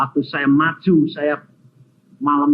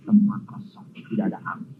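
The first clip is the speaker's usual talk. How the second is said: whisper; 3 semitones lower, 8 dB quieter than usual. Acted. Preacher drops to whisper.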